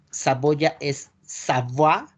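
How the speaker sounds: Savoie said slowly as sah-VWAH; French pronunciation. Shown as a demonstration. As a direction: stretch out Savoie, oi as wa. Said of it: "Cuando vean una O y una I".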